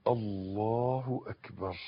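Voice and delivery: slow, soft, low takbir; prayer takbir. Shown as a demonstration. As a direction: say it slowly and softly, as in prayer. Demonstrates prayer opening. (تكبير هادي وبطيء)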